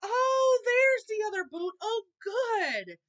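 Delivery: loud, very high excited shout. A narrator's reading. Wicked Elf spotting the second boot, shouting high and loud. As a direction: yell with greedy delight. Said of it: "he saw the boot and shouted".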